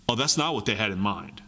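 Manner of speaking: ordinary delivery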